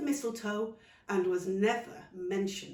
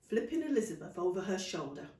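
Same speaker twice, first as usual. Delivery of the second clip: plain reading voice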